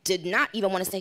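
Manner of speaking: ordinary talk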